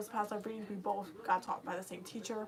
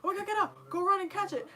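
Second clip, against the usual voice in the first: high, loud urgent cry; girlfriend panicking; 10 semitones higher, 6 dB louder than usual. Retold or imitated. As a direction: shout at her to go catch it. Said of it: "she was like".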